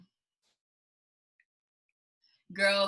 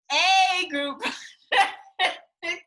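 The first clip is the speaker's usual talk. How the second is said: laughing; 7 semitones higher, 5 dB louder, much slower than usual. Real, not acted. Mocking group project, laughing.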